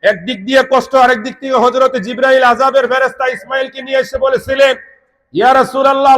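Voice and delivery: high, forceful sermon voice; impassioned preaching. Real, not acted. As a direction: proclaim the angel's message to the congregation. (উঁচু গলায় জোরালো ওয়াজ)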